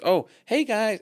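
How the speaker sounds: put-on high, chirpy American accent; switched American accent. Shop woman switching for the tourists, high American accent. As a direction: greet them in exaggerated American. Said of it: "she had the American accent".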